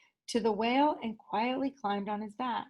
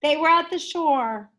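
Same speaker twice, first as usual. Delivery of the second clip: usual speaking voice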